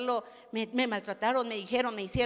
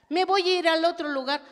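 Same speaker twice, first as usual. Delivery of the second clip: defiant outburst; 6 semitones higher, 10 dB louder than usual. Retold or imitated. Imagined defiant declaration.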